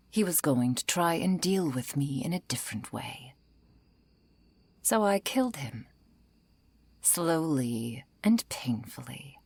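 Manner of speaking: whispered confession, slowing at the end; chilling whisper. Acted. Vampire whispering his confession to the hunter. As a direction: hush it, savour the last words.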